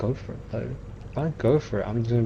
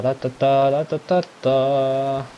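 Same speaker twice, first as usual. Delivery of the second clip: ordinary talk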